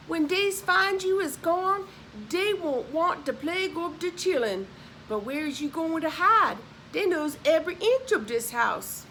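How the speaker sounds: lightly voiced old Southern servant. Sally warning Linda about her hiding place, lightly voiced Southern dialect.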